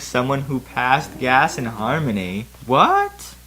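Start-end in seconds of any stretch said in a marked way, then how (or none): 2.7-3.3 high startled squeak, much higher; shocked disbelief at the sound; startled what. Real, not acted. Baffled reaction to gas sound.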